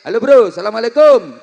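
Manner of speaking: high, loud buddy hello; greeting a friend met on the road; casual friend greeting. Shown as a demonstration. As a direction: call out to a mate on the street. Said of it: "salamnya nggak kayak M C".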